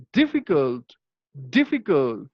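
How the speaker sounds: "difficult" with a strong DIF, the other syllables falling away; stress on DIF. Shown as a demonstration. Teacher modelling syllable stress, "difficult" stressed on DIF. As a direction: lean on DIF at the start of "difficult". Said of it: "how to stress upon this syllable".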